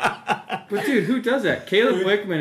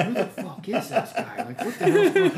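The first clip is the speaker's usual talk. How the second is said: high, laughing disbelief; retold shock; 9 semitones higher than usual. A shy seventh grader hearing a classmate fart, laughing shock. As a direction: squeal it out in amused shock. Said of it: "And I'm like".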